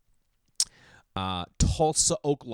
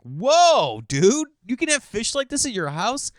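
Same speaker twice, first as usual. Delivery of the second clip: much higher, louder awed whoa; amazed gasp; 12 semitones higher, 8 dB louder than usual. Retold or imitated. Customer amazed at saltwater fish, excited high voice. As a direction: gush like a kid seeing exotic fish.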